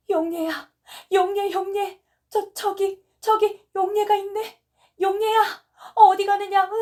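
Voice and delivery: high, loud frantic shouts, rising; frantic shouting. Acted. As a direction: scream after a daughter being taken away. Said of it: "고함을 치고 눈물 없는 울음을". (높고 크게 미친 듯 외치는 고함)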